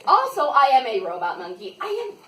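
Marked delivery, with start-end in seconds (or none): none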